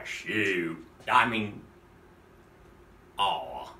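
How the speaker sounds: normal talking voice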